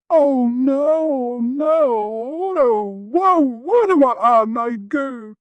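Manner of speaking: high, loud, tearful wail; panicked crying. A narrator's reading. Darkness panicking in the bathroom, crying loudly. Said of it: "in a certifiable panic".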